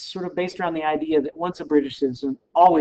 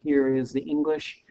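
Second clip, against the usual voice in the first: ordinary talk, nothing marked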